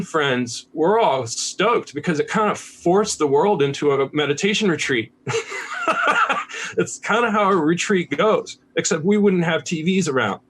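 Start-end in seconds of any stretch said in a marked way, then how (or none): 5.2-6.7 high burst of chuckling; amused, high-pitched laugh. Real, not acted. Meditator tickled by own retreat comparison, laughing.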